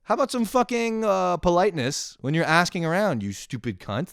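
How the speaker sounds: high, exasperated comic scolding; indignant. Acted. Helper scolding a rude direction-asker, high exasperated rant.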